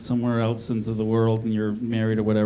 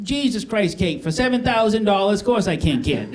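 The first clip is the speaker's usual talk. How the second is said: high-pitched, put-on Boston accent; botched Boston accent, sliding toward Maine; 6 semitones higher than usual. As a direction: answer in a hammy Boston accent. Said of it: "can you do a Boston accent".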